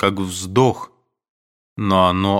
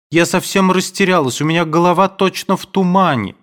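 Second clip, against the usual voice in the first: high, louder, flustered and lost; 10 semitones higher, 4 dB louder than usual. A narrator's reading. Nadenka admits to Aduev she's lost, high flustered voice. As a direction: sound at a loss, head spinning. Nadenka confused, head in a fog.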